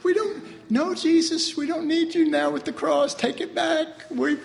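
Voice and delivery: high, laughing brush-off to Jesus; mock dismissal. Preacher mocking believers telling Jesus no cross needed, high and cheeky. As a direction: wave Jesus away, cheeky and amused.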